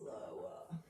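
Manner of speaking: real hushed whisper; barely audible instructor's cue. Real, not acted. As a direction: whisper it very softly, like a breath. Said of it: "I don't know why I'm whispering".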